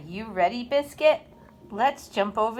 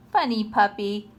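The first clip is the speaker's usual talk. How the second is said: plain reading voice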